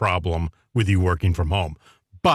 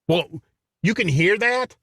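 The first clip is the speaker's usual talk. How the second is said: high, incredulous mock question; clueless caller; 10 semitones higher than usual. Imitating the noisy coworker on a conference call, high and clueless. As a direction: imitate an oblivious caller, pitched up.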